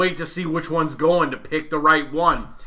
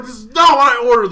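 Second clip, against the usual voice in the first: indignant complaint; 6 semitones higher, 7 dB louder, faster than usual. Retold or imitated. Complaining at counter.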